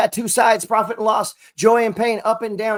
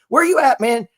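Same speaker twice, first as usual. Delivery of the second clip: calling out; 6 semitones higher, 7 dB louder than usual. Acted. Calling out to potential followers.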